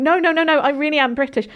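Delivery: insist, pitch lifted, as if disbelieved; insistent protest. Retold or imitated. Retold protest against being disbelieved.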